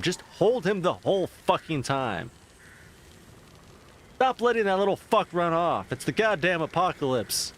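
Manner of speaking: fed-up outburst at a careless owner; exasperated rant. Real, not acted. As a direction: yell at the screen about the cat. Ranting at the movie character.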